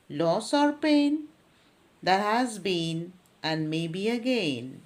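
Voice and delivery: poetry read aloud to students; poem recitation. Acted. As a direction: recite in measured phrases, pausing at each line. Reads third stanza aloud.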